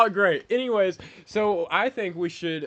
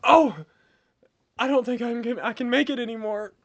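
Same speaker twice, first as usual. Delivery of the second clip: dramatic dying; 7 semitones higher, 6 dB louder than usual. Acted. Improv dying.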